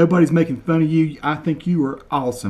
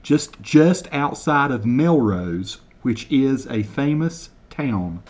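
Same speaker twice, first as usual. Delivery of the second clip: slow, deliberate over-enunciation of each word; slow mock translation; slower than usual. Shown as a demonstration. Translating Kevin's Scottish for viewers, slow and deliberate. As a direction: spell it out slowly for Americans, deadpan. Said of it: "translate word for word".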